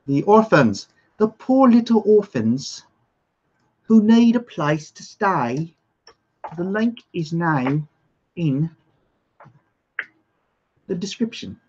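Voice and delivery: hammed-up plea for orphans, slow and lifted; exaggerated sob-story appeal; mock pitiful plea. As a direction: plead theatrically, stretching the words.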